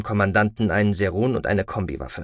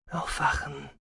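hushed, much softer wake-up call; softly spoken; 4 semitones higher, 9 dB quieter than usual. A narrator's reading. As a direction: rouse someone very quietly. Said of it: "sagte er leise". (leise und behutsam)

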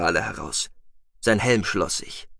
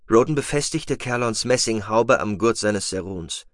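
usual speaking voice

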